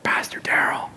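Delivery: strained, voiceless whisper; lost voice. Acted. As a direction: force out words with no voice. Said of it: "I can't talk".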